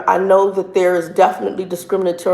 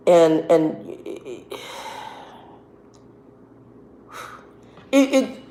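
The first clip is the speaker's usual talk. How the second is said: halting, stuck on words; can't get the words out; stuttering; 2 semitones lower, 6 dB quieter, slower than usual. Real, not acted. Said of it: "I'm up here stuttering".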